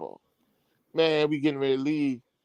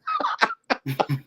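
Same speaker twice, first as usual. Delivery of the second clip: high, bursting laugh; high burst of laughter; 3 dB quieter than usual. Real, not acted. Telling the story to the host, a high burst of laughter.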